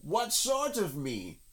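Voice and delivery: high, slow put-on voice; high little creature voice; doubting rabbit. A narrator's reading. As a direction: query it warily from inside.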